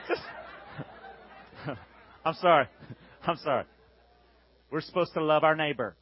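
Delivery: ordinary delivery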